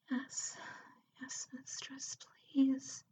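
breathless whispered pleading; panting. Acted. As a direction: pant the words out between breaths. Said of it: "she panted".

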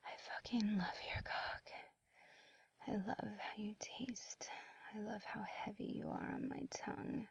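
soft breathy whisper into the ear. Acted. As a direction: lean close and whisper it. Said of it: "Here, I'll whisper it in your ear".

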